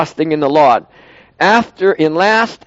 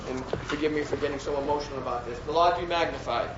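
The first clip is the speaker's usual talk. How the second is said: much softer, choked-up, near tears; moved to tears over the martyrs; emotional; 11 dB quieter than usual. Real, not acted. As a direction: speak through real emotion, barely holding it. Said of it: "I get emotional over it".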